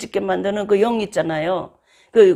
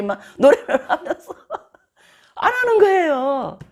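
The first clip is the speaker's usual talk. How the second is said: laughing; 8 semitones higher than usual. Real, not acted. Laughs about hypocrisy.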